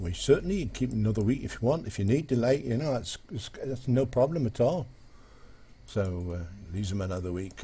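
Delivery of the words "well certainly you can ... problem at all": higher, quick Geordie; Geordie accent. Acted. Geordie father agreeing to another week, in a higher, lilting Geordie accent. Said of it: "And the Geordie said".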